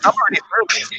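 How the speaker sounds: high camp impersonation; hammed-up effeminate window dresser; Paul Mobs. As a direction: keep it high-pitched and camp. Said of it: "that's how he talked".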